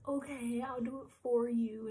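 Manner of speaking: high, tipsy, gushing cousin voice; drunk cousin. Retold or imitated. Tipsy cousin comforting crying Liana in the car, high gushing voice. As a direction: play the drunk cousin promising a new phone. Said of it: "She's like".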